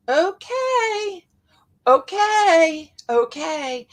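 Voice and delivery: high, drawn-out okay, okay, okay, fading; caving in sweetly to every ask; reluctant okay. Retold or imitated. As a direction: pushover saying yes again and again. People-pleaser giving in repeatedly.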